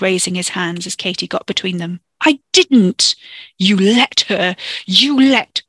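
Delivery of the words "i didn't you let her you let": loud, heated outburst; angry quarrel. Acted. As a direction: hurl it back in a row. Acted shouting quarrel between cousins.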